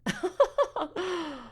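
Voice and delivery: high, short acted laugh; haughty laugh. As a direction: laugh scornfully at the child's expense. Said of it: "She laughed".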